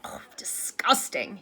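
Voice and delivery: slow, whispery groan of disgust. A narrator's reading. Lupin having just drained the foul goblet, disgusted. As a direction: recoil from a foul taste. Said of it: "pulled a face".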